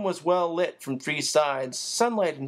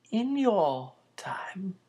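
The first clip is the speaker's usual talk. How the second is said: whisper; 4 semitones higher, much slower than usual. A narrator's reading. Old man whispering aside.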